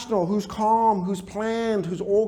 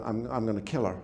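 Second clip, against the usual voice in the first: put-on angry child muttering; 8 semitones lower, 7 dB quieter, faster than usual. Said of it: "Angry child's gonna".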